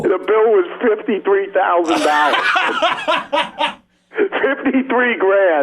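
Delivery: laughing through the punchline, slow; slow amused reveal. Real, not acted. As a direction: chuckle out the big number.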